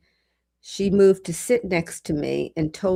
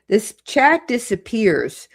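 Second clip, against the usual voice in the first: ordinary talk, nothing marked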